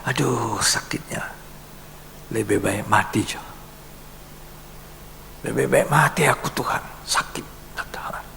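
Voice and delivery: hushed, pained moaning plea; a sick person, hushed and pleading to die; suffering sick person. Acted. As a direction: whisper it like someone worn out by pain. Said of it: "Ada orang sakit".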